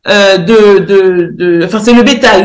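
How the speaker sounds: usual speaking voice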